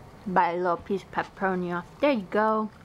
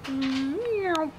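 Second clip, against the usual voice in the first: cat meow; 6 semitones higher than usual. Pretend. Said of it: "Did you just go meow?".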